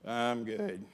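low, soft, unconvincing "good" that falls away; flat, defeated "I'm good"; deflated good. Retold or imitated. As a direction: say it with no conviction at all. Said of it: "the last one".

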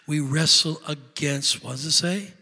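ordinary talk, nothing marked

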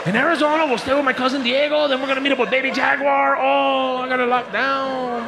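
high, bouncy cartoon-kid voice; cartoon Dora parody. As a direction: play the chirpy explorer.

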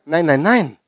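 ordinary talk

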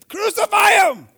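high, loud shout of crucify; mock mob shout. Preacher voicing the gossip's cry, a loud high shout. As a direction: yell it like the accusing crowd.

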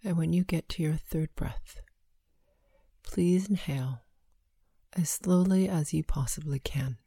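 slow, hushed breath counting. Acted.

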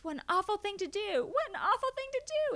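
hammed-up offended wail; hurt complaint. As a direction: squeal it like a bitten dentist. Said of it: "He says".

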